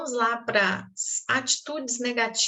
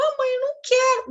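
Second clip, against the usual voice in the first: put-on much higher, whining child; child's whine; 12 semitones higher, 4 dB louder than usual. Boy whining to his mother, high and pleading. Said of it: "Quando eu falava".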